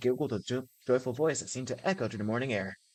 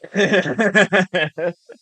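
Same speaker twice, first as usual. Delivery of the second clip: loud burst of laughter, pitched up; 6 semitones higher, 11 dB louder than usual. Real, not acted. Player cracking up at the game, loud laugh.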